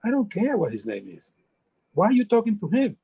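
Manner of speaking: put-on a touch of jealous boyfriend; mild retold anger. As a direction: repeat it with rising irritation. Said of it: "He said".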